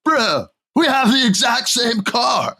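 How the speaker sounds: high, excited mock shout of discovery; super excited dude. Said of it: "he's super excited".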